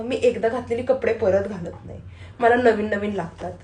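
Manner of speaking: ordinary talk, nothing marked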